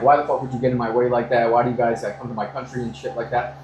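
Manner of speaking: usual speaking voice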